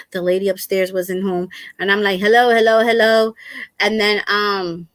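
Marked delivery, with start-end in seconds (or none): 2.2-3.3 calling hello. Retold or imitated. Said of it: "yelling really loud".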